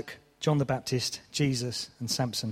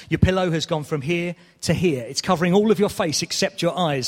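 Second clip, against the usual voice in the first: ordinary talk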